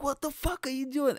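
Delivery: high-pitched retort in mock panic; panicked snapping. Panicking comedian snaps at a heckler, high and rattled. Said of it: "I kinda snapped because I'm so panicking".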